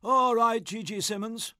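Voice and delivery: a hint of an over-cheery, tipsy prize-giver; chummy and a touch sloshed, lightly played; lightly voiced tipsy Gussie. Tipsy Gussie chats to a prize-winning boy, lightly voiced.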